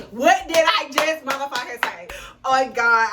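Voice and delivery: loud, high disbelief; loud shocked exclaim; excited outcry. Real, not acted. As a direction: still reeling from the surprise. Still shocked about the money.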